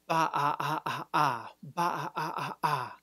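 longer run of throat pulsed ah; throat stop drill. Shown as a demonstration. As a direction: keep pulsing ah from the throat. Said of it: "a little bit longer".

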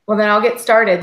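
ordinary talk, nothing marked

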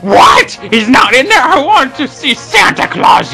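indignant outburst. Acted. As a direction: explode in outrage, pitch way up. Angry outburst at others.

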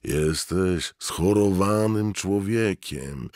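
high, drawn-out wail. A narrator's reading. Inner voice wailing at Mort, high and drawn out. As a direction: wail his name like a mourner. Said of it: "zawodził głos".

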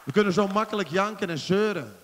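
loud, slow, building sermon cry; preaching at full force. Acted. Preacher urging praise of the church, loud and slow. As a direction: proclaim it, slow and rising.

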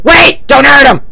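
urgent cry to stop; alarmed shout. Acted. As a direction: cut in with an alarmed yell. Pleading as a countdown runs out.

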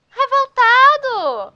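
slow high drawn-out huff; mock indignant. Real, not acted. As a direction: stretch it out in comic outrage. Horse leaves, mock indignation.

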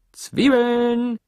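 high, drawn-out hawker's cry; street vendor's call. A narrator's reading. Sam selling onions out on the street, calling out. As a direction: sing out loudly, holding the last word long. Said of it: "hörte sie Sam draußen auf der Straße rufen".

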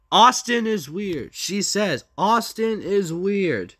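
high, loud, slow repetition; indignant quote. Retold or imitated. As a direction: quote the insult in outraged disbelief. Indignantly quotes insult about friend.

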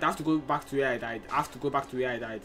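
quick low grumble; grumbling to himself. Real, not acted. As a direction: mutter it under your breath in a hurry. Grumbling about corpse run.

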